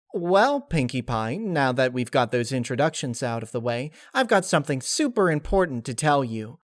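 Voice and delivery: high, bouncy and eager; high cheerful spirit voice; chirpy Laughter voice. Acted. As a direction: sell the big news like a giddy kid. Laughter speaking, excited.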